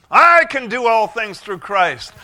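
booming preacher's declaration; loud slow proclamation. Shown as a demonstration. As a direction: shout it out as a faith declaration. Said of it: "You say".

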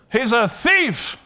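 high, loud, drawn-out declaration; slow it down and shout it out; emphatic preaching shout. Real, not acted. Preacher denouncing the devil to the congregation, loud emphatic shout.

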